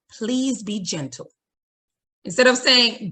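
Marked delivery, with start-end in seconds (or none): 0.1-1.3 slower and soft, kindly asking; gentle request. Shown as a demonstration. Teacher guiding a student who hits, gently. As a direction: ask tenderly, not as an order.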